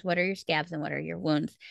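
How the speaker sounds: usual speaking voice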